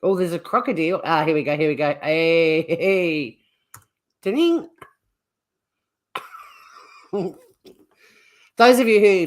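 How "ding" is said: a high sung ding like a notification bell; ping it out like a phone alert, much higher; chime imitation. Sound of a message arriving.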